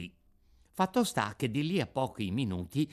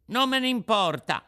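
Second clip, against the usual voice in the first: high, loud stubborn retort; defiant puppet child; 11 semitones higher, 6 dB louder than usual. Acted. Puppet to the warning Fairy, defiant. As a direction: throw it back high and loud.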